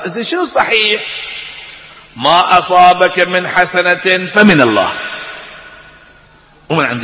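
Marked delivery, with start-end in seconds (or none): 2.2-4.9 Quran recitation. Acted.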